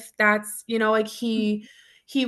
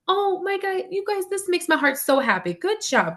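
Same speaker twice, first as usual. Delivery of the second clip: much higher, gushing praise; excited praise; 10 semitones higher than usual. Retold or imitated. Teacher praising the little boy at lunch, excited and gushing. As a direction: gush over him like he just did something amazing. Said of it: "'Cause I say".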